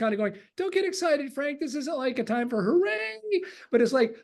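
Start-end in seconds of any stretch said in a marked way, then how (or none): 0.6-3.2 high, teasing mock caution; playfully tamp down his excitement; mock restraint. Telling Frank not to get excited, high teasing mock caution. Speaker voicing own reply to Frank.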